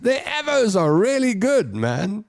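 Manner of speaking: high-pitched, breaking into laughter; laughing protest. Real, not acted. Laughing while defending the shop.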